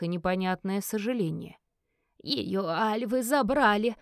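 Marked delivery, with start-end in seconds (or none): 2.2-3.9 put-on slow, tearful old woman; sobbing. A narrator's reading. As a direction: sob it out in grief. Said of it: "всхлипнула травница".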